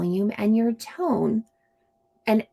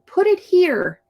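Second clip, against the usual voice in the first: loud, much higher "Put it here."; demanding shout; bossy command; 10 semitones higher, 5 dB louder than usual. Shown as a demonstration. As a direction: snap the order like a bossy kid. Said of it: "The bossy friend might say".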